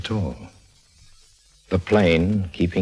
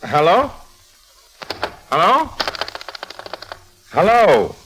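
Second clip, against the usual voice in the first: hello, louder each time; calling into the phone; 13 semitones higher, 4 dB louder than usual. Acted. [name] calls after the caller hung up, growing louder. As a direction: shout into a dead line.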